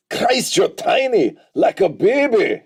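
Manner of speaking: on-the-fly Scottish impression of a movie character; big mock Scottish accent; rough Scottish accent. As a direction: quote it in an improvised, un-warmed-up Scottish accent. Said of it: "I didn't warm up my Scottish accent".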